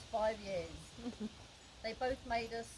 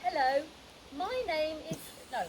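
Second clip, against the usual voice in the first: rehearsed border line; 6 semitones higher, 9 dB louder than usual. Retold or imitated. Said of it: "me repeating over and over again".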